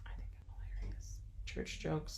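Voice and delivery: whispered amused aside; whispering. Real, not acted. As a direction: whisper it to yourself. Joking aside after whispered line.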